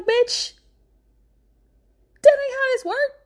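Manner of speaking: high, exasperated mock judge voice. Recapping the show, the judge scolds Jasmine in a high mock voice. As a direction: squeak it out like a fed-up judge.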